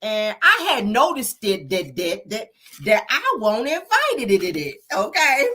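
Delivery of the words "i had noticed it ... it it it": mock over-pronounced, repeating final D's; extra D's on the end. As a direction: stutter repeated D's after "noticed" and "invited". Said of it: "Put all them D's on the end".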